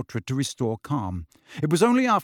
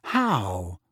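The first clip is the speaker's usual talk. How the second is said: low, puzzled question; perplexed; 5 semitones lower than usual. A narrator's reading. Boy asking how he spoiled the comeback, perplexed. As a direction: ask it in bafflement. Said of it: "Harry asked perplexedly".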